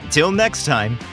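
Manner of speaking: ordinary delivery, nothing marked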